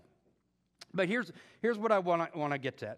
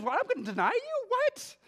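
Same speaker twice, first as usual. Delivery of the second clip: much higher, incredulous rise on what; shocked Peter; 5 dB louder than usual. Acted. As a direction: Peter startled, hearing only the denial. Peter reacting in disbelief.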